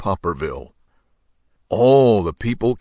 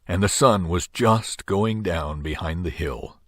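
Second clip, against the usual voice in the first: ordinary delivery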